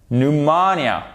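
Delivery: "Pneumonia" as "nu-MO-nia"; stress on MO. Shown as a demonstration. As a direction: swell on the second syllable of "Pneumonia". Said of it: "on fait bien l'accent tonique".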